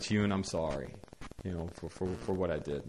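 soft, low and contrite; quiet apology. Retold or imitated. Caretaker saying sorry to the boy, quiet apology. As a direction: apologise gently, voice dropped.

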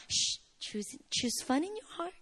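very soft shushing mother; acted soft, whispery coaxing; soothing hush. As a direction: hush the child and coax gently. Hushing a complaining child.

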